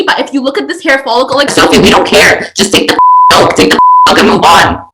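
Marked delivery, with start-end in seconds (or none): 1.5-4.9 exasperated shout. Retold or imitated. Friends mock-arguing on camera.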